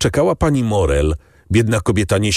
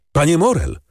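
raised-pitch call, mildly shouted; 6 semitones higher than usual. A narrator's reading. Someone on the stairs calls for Morel, shouting mildly. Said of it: "krzyczał ktoś na schodach".

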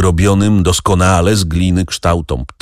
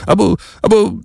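ashamed stammer; faster than usual. A narrator's reading. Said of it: "jąkał się zawstydzony".

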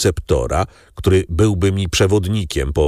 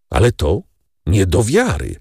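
plain reading voice

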